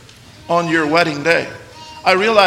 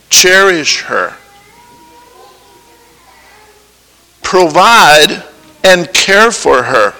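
solemn vow reading; slower than usual. Acted. Preacher reading the covenant to the groom, slow. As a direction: weigh every word of the vow.

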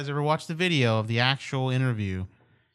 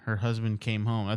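usual speaking voice

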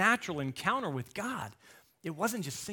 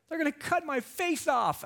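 higher mock panicked little girl; scared child; 12 semitones higher than usual. Eleven-year-old at the dentist, mock panic. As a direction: squeak it out like a frightened kid. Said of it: "What are you hearing as an eleven-year-old?".

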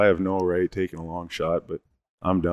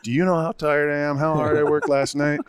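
higher, slow, exasperated scolding; mock scolding dad; 8 semitones higher than usual. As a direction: imitate a tired father chewing out his son. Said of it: "My dad tore a strip off of me".

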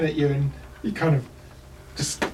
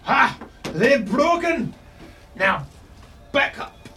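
gruff dwarf; 10 semitones higher than usual. Acted. Dwarf captain rallies his men up the stairs, high and shouting. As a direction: bellow orders like a battle-mad dwarf. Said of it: "a dwarvish voice resonating".

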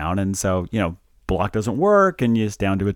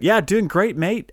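higher-pitched, lightly Australian-tinged reply; Australian accent; 9 semitones higher, slower than usual. Real, not acted. Guest greets co-host as mate, in a light Australian accent. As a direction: answer with a hint of an Australian accent. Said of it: "was that an Australian accent I detected".

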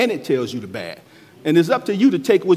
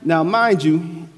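ordinary delivery

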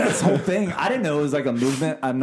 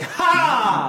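realisation laugh; 9 semitones higher than usual. Retold or imitated. Sudden realisation retold.

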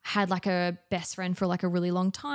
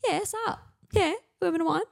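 mock excited invite; 10 semitones higher, faster than usual. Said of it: "I'm like".

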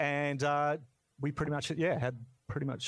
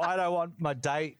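ordinary talk, nothing marked

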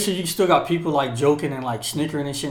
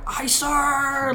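high-pitched, strained 'aye, sir'; mock recruit scream; 11 semitones higher than usual. Recruit screaming at the instructor's cover, high mock scream. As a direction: imitate a recruit screaming his reply. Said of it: "You're gonna scream my cover back".

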